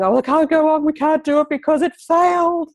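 high-pitched, hammed-up defeat; mock despair. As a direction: wail it like someone collapsing in a heap, playfully. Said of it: "we're not falling in the ground in a heap and deciding".

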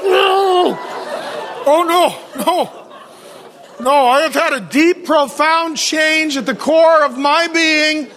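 high, loud horrified no; loud comic refusal. Retold or imitated. Pastor refusing his wife's candy, in a loud mock horror.

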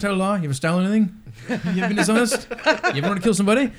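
mock Australian street preacher, loud and laughing; Australian accent. Mocking the Australian evangelist, loud Aussie accent. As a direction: ham up an Aussie preacher grilling a stranger. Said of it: "with this Australian".

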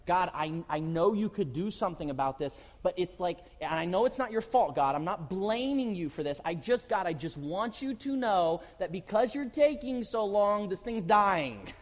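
loud pleading frustrated prayer; exasperated prayer. Retold or imitated. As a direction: complain to God, building up. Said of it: "and you're like".

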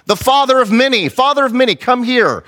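high-pitched summons, mock yell; acted yelling. Sarah summoning Abraham by his new name, mock yelling. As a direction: call out as if across the yard, pitched high. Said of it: "She was yelling".

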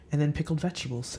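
normal talking voice

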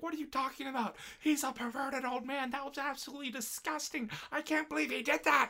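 high, loud disgusted rant; disgusted girl. Retold or imitated. Hanako ranting about the teacher to Olivia, high disgusted. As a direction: rant it like an appalled anime girl. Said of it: "Hanako's like".

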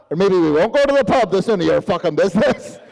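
put-on loud, brusque Northern Irish accent; Northern Irish refusal. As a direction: shut down the question in Northern Irish. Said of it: "sounded like an interrogation that was going nowhere".

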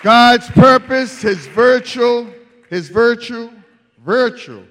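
loud, high, slow proclamation; full-force preaching. Real, not acted. Preacher listing God's virtues, loud and high. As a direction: hammer out each phrase to the church.

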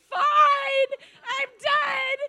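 high, hammed-up shout; dramatic surrender. Smoker breaking cigarettes in surrender, dramatic retold shout. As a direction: cry it out like a melodramatic breakdown. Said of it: "I was so dramatic".

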